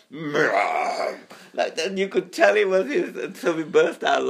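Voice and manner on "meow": hoarse sick meow imitation; imitating a cat with a cold. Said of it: "this morning was like".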